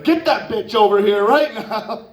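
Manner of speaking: mock eager order; laughing demand. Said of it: "It's like".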